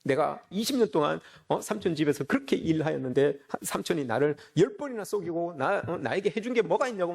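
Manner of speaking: usual speaking voice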